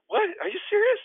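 squeaky, loud disbelief; acting out a flabbergasted reaction; incredulous. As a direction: shoot up high in exasperated disbelief. Retold disbelief at second snub.

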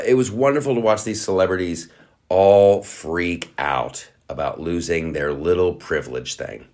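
ordinary delivery

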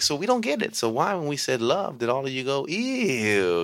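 high, hammed-up bafflement; mock puzzled teacher. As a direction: play it innocently confused for the kids. Teachers voicing mock confusion.

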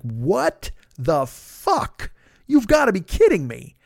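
retold disbelief. Hears of lien, retold shock.

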